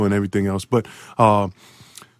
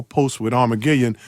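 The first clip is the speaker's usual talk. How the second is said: ordinary delivery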